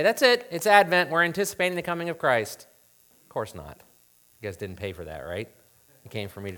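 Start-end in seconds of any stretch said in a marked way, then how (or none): 0.0-2.7 loud, high, hurried sign-off; mock wrap-up. Preacher pretending to end the talk early, mock hurried wrap-up. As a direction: toss it out loudly as if finished. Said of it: "just say".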